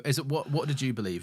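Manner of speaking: normal talking voice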